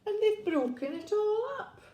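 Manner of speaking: very high, tiny cub's wail; tearful Baby Bear. A narrator's reading. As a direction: complain like a little bear in tears. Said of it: "tears streaming down his face".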